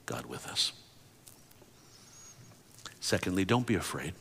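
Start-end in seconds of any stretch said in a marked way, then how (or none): 0.1-0.7 low unvoiced whisper. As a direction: hush it like a secret. Whispered close.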